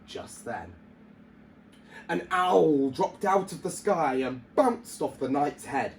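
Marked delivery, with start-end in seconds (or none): none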